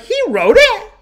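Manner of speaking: very high, loud incredulous cry; imitated group amazement. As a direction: shriek it as a whole crew's mock shock. Group astonished, acted.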